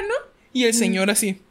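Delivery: usual speaking voice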